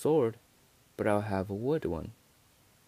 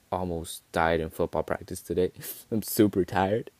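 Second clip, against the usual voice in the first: ordinary talk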